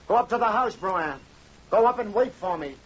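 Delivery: an agitated husband barking orders; urgent orders. Acted. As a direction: send her off firmly and fast. Husband orders wife home urgently.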